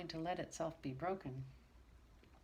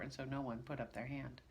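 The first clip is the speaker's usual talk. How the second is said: usual speaking voice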